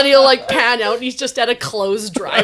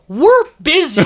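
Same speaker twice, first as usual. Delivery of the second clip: high, loud, laughing dismissal; mock brush-off; 7 semitones higher, 7 dB louder than usual. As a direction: play kids too busy to be bothered. Kids brushing off would-be playmates.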